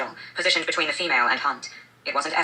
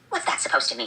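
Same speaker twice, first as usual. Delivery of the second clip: high sharp harpy voice, far from the reader's own; 10 semitones higher than usual. A narrator's reading. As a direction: read the harpy's line pitched way up. Harpy voiced in the read passage.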